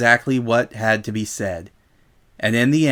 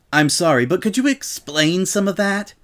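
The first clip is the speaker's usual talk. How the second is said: Rainbow Dash voice; 7 semitones higher than usual. Acted. Rainbow playing dumb.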